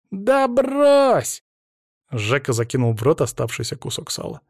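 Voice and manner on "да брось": put-on very high, drawn-out boyish dismissal; scoffing boy. A narrator's reading. Boy dismisses the man's warning, high scoff. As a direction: brush it off high, like a cocky kid.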